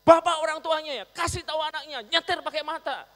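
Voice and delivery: very high, loud furious scolding; angry yelling. Retold or imitated. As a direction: yell at the old man about his reckless son. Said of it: "orang yang marah itu terus ngomong". (bentakan marah, tinggi dan keras)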